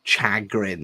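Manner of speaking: "Chagrin" said with a hard ch, shuh-GRIN, falling; testing "Chagrin" with a firm ch and falling end; trying a new word. Shown as a demonstration. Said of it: "not a word commonly used upon my lexicon".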